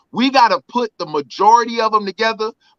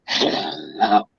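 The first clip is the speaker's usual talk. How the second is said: a much lower, put-on villain growl for hello; Gargamel voice; 6 dB quieter than usual. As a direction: play the boss as a cartoon villain, deep and slow. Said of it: "my boss had the nerve to look at me and say".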